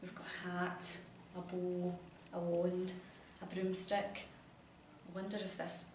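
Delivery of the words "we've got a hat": usual speaking voice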